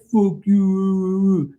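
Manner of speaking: put-on high, long-drawn drunken retort; mock drunk guy. Drunk guy talking back to the captain, high drawn-out mockery. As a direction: imitate a sloppy drunk, stretched out high. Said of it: "So the guy's like".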